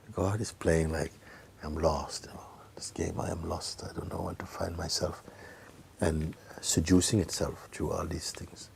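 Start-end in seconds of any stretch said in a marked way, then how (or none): 1.6-5.2 mock lost whisper. God playing at being lost.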